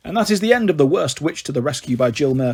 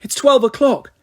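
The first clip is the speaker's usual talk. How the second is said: higher, louder call over her shoulder; calling back; 6 semitones higher, 3 dB louder than usual. A narrator's reading. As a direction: call it back while running. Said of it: "Mildred called back".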